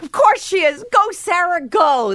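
cheering onlooker. Retold or imitated.

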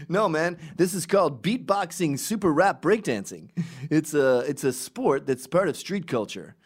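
hammed-up street guy explaining; mock dancer reply. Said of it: "they can go like".

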